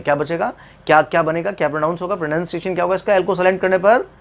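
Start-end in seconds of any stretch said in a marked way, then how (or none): none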